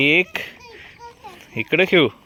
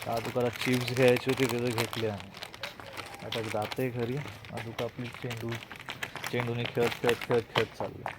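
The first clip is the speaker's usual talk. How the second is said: quiet vlog aside; 10 dB quieter than usual. Real, not acted. Parent tells the camera what they are doing next, soft and quiet. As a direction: talk softly, just for the viewers.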